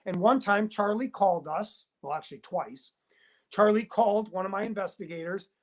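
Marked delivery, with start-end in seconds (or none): none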